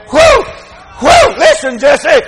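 high, loud imitated whoops, then a call; whoop, then holler to your wife; fired-up father shouting through the house; excited whoop and call. Acts father whooping, calling mother.

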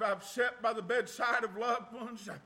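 ordinary talk

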